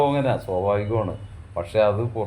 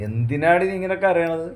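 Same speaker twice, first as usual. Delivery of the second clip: much higher, exasperated question; exasperated surprise; 9 semitones higher, 3 dB louder than usual. Acted. Husband reacting to his wife suddenly crying, exasperated. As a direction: throw up your hands at her crying.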